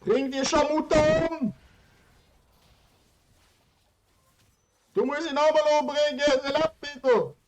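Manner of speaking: high, loud, fast shouting; yelling. Real, not acted. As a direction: yell frantic orders. Yelling at teammate in game.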